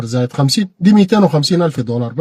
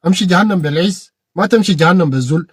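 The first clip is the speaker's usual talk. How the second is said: ordinary delivery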